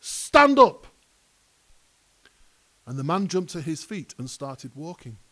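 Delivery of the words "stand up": high, booming command; sudden full-voiced shout, much higher; loud call. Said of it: "called out to him in a loud voice".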